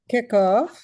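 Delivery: ordinary delivery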